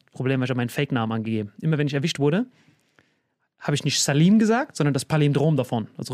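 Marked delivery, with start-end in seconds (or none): none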